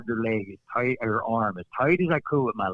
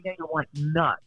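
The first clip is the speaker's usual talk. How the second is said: ordinary talk, nothing marked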